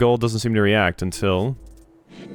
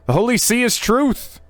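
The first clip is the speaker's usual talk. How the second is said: much higher, ringing cry; zealous proclamation; 13 semitones higher than usual. Acted. The bird proclaims its lord to the visitors, much higher. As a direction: proclaim it like a true believer.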